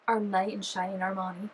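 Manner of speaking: normal talking voice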